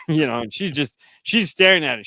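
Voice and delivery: ordinary talk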